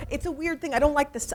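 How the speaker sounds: ordinary delivery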